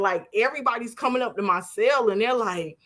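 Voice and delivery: ordinary talk, nothing marked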